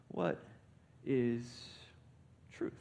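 "What ... is ... truth" word by word; a slow "What is truth", each word left hanging; weighty pauses. Retold or imitated. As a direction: set "What", "is" and "truth" apart with long pauses. Preacher repeats the question for weight.

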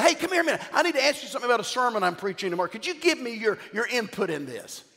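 a lightly voiced, fast, high call out the car window; a hint of a friendly plea; imitating his call. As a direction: act out calling a stranger over, rushed. Acted quote to a homeless man.

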